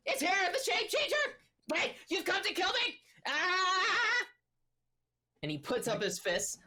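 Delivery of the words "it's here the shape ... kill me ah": high, frantic gnome shrieking in terror; panicked gnome. Acted. DM voices panicked gnome Korboz.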